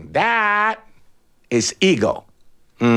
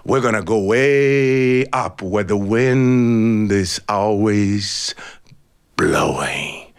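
much lower, very slow eagle voice; slow grand eagle; 8 semitones lower, much slower than usual. A narrator's reading. Eagle telling the chicken where they will fly, slow and grand. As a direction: promise the strong wind, slowly.

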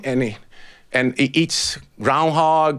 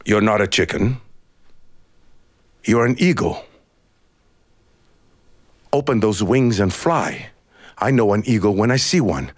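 much lower, firm eagle voice; commanding eagle; 7 semitones lower than usual. A narrator's reading. Eagle tells the chicken it is an eagle, commanding. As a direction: command it to believe in itself.

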